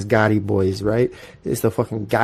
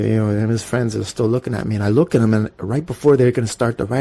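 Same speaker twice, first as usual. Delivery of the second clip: normal talking voice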